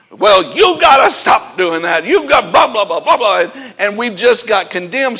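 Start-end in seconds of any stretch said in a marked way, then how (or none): 0.1-3.4 loud, hectoring mock scolding; mock a preacher berating the congregation; condemning preacher. Preacher scolding sinners in the past, mock condemnation. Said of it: "we have preached this with condemnation".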